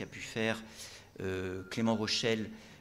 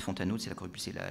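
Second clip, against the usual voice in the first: usual speaking voice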